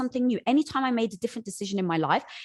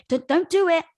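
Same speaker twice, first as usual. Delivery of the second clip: higher, louder pleading; urgent warning; 8 semitones higher, 8 dB louder than usual. Retold or imitated. The ego protecting her, loud urgent warning. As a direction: stop them at the edge. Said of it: "it's trying to protect you to say".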